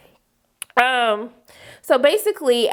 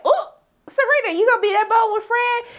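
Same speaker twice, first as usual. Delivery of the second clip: high, scandalized reaction; mock astonishment; 12 semitones higher, 4 dB louder than usual. Viewer teasing Serena about being bold, high and amazed. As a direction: pitch shoots up in playful disbelief.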